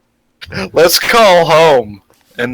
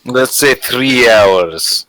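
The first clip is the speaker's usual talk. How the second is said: put-on Russian accent; much slower than usual. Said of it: "speak in English in a Russian accent".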